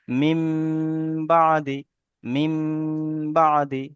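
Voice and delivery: "মিম" with the final m hummed long, then "বাদি"; ghunna recitation. Shown as a demonstration. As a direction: hold the nasal m of "মিম" for a beat before "বাদি". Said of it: "একালি পরিমাণ গণনা করে পড়তে হবে".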